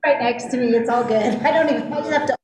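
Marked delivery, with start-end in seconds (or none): none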